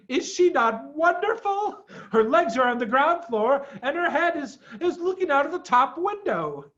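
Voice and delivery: high, excited bird voice with laughter; pelican character voice. A narrator's reading. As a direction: play the proud pelican, giggling. Pelican voice in story.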